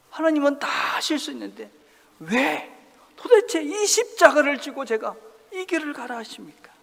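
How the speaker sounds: loud, high anguished questioning; Jesus pleading. Acted. Jesus asking God why the cross, loud and pleading. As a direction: press the why with urgency. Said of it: "주님께서 지금 말씀하시는 거죠".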